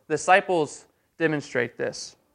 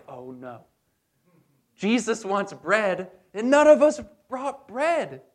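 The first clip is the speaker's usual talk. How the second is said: high mock panic, rising to a loud, high cry; playing the frantic disciples for laughs; panicked disciple; 5 semitones higher, 4 dB louder, slower than usual. Disciples fretting to Jesus over bread, high mock panic.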